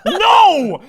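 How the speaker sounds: high, loud denial; indignant shout. Real, not acted. Host denying his hair is a toothbrush, high indignant shout. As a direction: shout the refusal.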